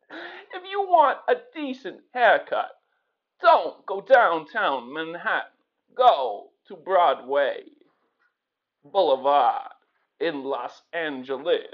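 halting, oddly paused delivery; [name] Walken. Acted. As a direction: leave long gaps in odd places. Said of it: "[name], that was a fantastic impression".

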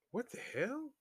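high, rising puzzled question; saying to oneself. Retold or imitated. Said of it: "I'm saying to myself".

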